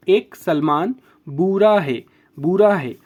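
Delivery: slowly, बुरा है drawn out; slow repeated mnemonic. Shown as a demonstration. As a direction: spell it out slowly for students. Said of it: "बहुत easy है". (धीमे-धीमे दोहराते हुए)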